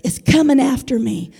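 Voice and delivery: emphatic preacher with punched key words; impassioned preaching. Real, not acted. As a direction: preach it with conviction to the congregation. Preaches to responding congregation.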